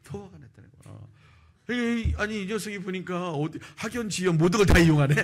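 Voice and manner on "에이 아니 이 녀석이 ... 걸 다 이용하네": loud, raised mock indignation; hammed-up indignant grumbling, high and loud; angrier boss. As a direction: act out a manager outraged at the cheek. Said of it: "이게 더 열받은 거야".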